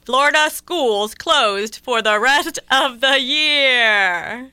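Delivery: slow, loud newscaster read; news headline read. Acted. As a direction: announce it like breaking news.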